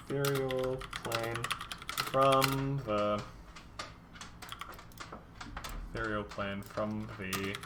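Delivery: drawn-out, halting words while writing; slow, piecemeal thinking-aloud delivery; slow dictation. Real, not acted. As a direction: sound it out slowly as you write. Composing text aloud while writing.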